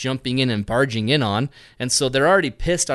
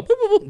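high beeps; 4 dB louder, much faster than usual. Retold or imitated. Mimicking light beeps.

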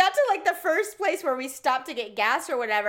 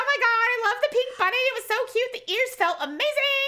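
high, breathless product praise; gushing fan; 14 semitones higher, 4 dB louder than usual. Pretend. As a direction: rave like a thrilled customer. Mock TV testimonial gushing.

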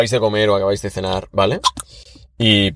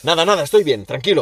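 tense dad brushing off; 5 semitones higher than usual. Acted. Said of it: "Se pone muy tenso".

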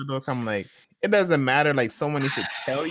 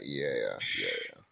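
fading murmur; 11 dB quieter than usual. Real, not acted. Trailing, fading to near silence.

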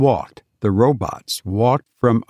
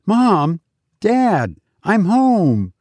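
put-on high-pitched child calling for his parents; boy calling out; 8 semitones higher, slower than usual. A narrator's reading. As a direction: call out high, like a boy arriving home. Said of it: "Johnny called".

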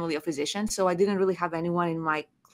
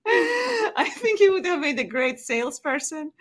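amused, laughing and pitched up; 8 semitones higher than usual. Real, not acted. Guest laughs recalling the surgeon's sales pitch, amused laughing. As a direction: giggle through it, half teasing.

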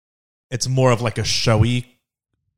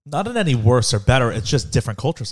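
usual speaking voice

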